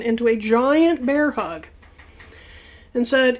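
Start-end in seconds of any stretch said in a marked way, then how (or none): none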